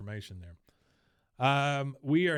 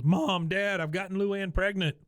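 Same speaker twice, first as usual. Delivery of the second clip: loud, high-pitched imitation of a boy bursting in; mock urgent announcement; 8 semitones higher, 4 dB louder than usual. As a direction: blurt out a big confession to the parents. Acting out Bobby bursting in with news.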